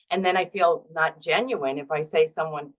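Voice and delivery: ordinary delivery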